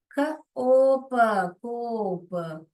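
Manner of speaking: ख, ओ, प sounded separately, then a drawn-out कोप; phonics blending. Shown as a demonstration. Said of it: "blend करने आ रहा है क्या". (ख, ओ, प अलग-अलग, फिर लंबा कोप)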